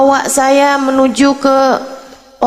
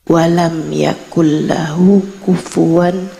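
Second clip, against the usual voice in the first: low, measured Arabic recitation; Quran recitation; 8 semitones lower than usual. Retold or imitated. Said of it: "Bacaannya begini". (membaca ayat Al-Qur'an dengan khidmat)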